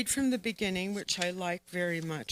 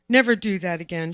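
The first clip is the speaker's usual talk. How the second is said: quick, mildly raised reprimand; understated stern nun's order; louder scolding; 8 dB louder, faster than usual. A narrator's reading. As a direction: scold a child briskly, a little louder. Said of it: "loud now".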